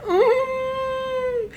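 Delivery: high-pitched mock-crying whine; drawn-out squeaky whimper. Reader overwhelmed by a sweet book, whimpering.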